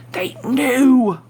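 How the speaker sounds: a sudden loud, horrified cry; panicked outburst. Acted. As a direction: burst out as it dawns on you. Narrator's panic breaks.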